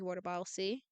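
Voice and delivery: ordinary delivery, nothing marked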